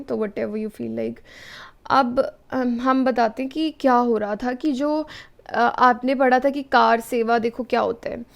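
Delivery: ordinary delivery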